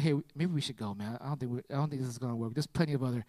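low, hushed aside; hesitant suggestion. Retold or imitated. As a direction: suggest it softly under your breath. Translator timidly suggests giving up.

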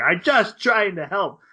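acted-out desperate protest; exasperated pleading. Acted plea while being tortured.

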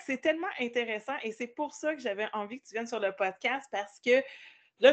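ordinary delivery

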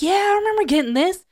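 high-pitched happy squeal of recognition; sing-song high delight at an unboxing. Retold or imitated. Acted reaction on opening a box.

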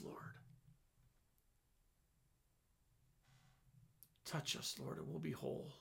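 soft, intimate prayer sinking to whispers. Acted. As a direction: pray it fervently and hushed.

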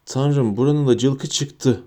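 ordinary delivery, nothing marked

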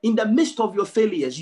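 ordinary talk, nothing marked

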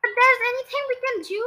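high, loud, rushed plea; crying out. A narrator's reading. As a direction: beg for help in a hurry. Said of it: "cried Missus".

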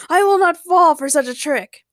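high, loud grand refusal; bombastic, scornful declaration; scoffing defiance. A narrator's reading. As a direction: refuse it like a pompous hero. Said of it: "Ray laughed heartily".

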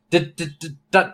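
ordinary talk, nothing marked

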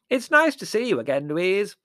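loud, high oblivious interjection; booming high aunt voice; hard-of-hearing aunt. A narrator's reading. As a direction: chime in loudly as if deaf. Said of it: "said Aunt Fan".

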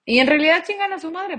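high, loud punch on chingan; loud exasperated burst; emphatic loud outburst. Acted. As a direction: blurt it out with force.

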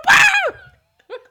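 shrill high yell, very loud; high screaming woo. Pretend. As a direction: scream it out like a victim. Mock victim screaming.

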